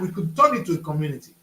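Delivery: ordinary talk, nothing marked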